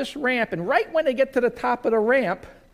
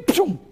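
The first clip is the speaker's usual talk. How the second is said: splash noise; 9 semitones higher, 6 dB louder than usual. Acted. Sheep flipped into the dip.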